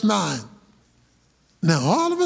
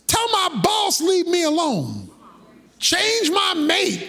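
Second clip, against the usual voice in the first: ordinary talk, nothing marked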